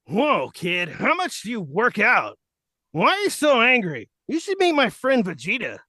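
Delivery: high, loud cheerful cartoon hero; Goku voice. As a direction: play a chirpy anime hero. Plays Goku to Bakugo.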